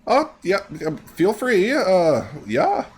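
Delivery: high, hesitant clerk voice; acted nervous fumbling, high-pitched; fidgety secretary. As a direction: give flustered permission, pitch way up. Fidgety secretary hesitantly gives permission.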